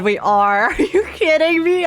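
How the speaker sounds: usual speaking voice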